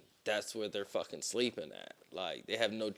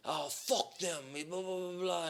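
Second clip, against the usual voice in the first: higher-pitched mock Haitian accent; 6 semitones higher, 3 dB quieter than usual. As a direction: imitate a Haitian man brushing it off. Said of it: "his accent".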